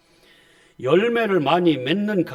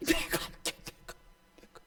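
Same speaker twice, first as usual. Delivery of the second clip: quiet divine whisper; 10 dB quieter than usual. Acted. God's quiet voice answering.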